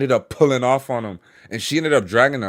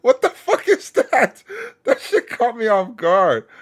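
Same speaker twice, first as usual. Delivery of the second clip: laughing; 9 semitones higher, slower than usual. Real, not acted. Streamer laughing at the clip.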